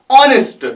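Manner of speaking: ऑनेस्ट starting on an open o, no h; honest with silent h. Shown as a demonstration. Teacher models ऑनेस्ट for students, no h. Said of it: "हम नहीं हॉनेस्ट बोलते हैं".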